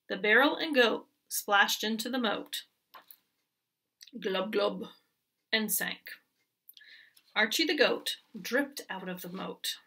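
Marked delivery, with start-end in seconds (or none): none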